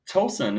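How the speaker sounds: first syllable of "Towson" said like "toe"; long-o pronunciation. Real, not acted. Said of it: "Maybe that's my Wisconsin accent".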